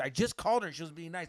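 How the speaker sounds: ordinary talk, nothing marked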